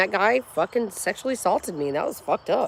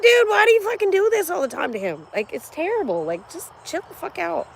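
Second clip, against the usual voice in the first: high, exasperated scolding of the ex; exasperated retort; 9 semitones higher than usual. Retold or imitated. Mom scolding her ex over the phone, exasperated. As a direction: snap back at the ex, fed up. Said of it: "I'm like".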